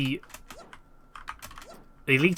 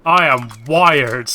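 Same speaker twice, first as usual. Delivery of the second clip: wired and jittery, slow and loud; buzzing on caffeine, drawn out; too much caffeine; 9 dB louder, slower than usual. Real, not acted. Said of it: "I am wired right now".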